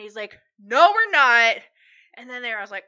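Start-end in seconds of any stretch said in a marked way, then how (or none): 0.6-1.6 loud imitated angry retort. Uncle contradicting aunt who says they're fine, angry retort. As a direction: snap back loudly as the fed-up uncle.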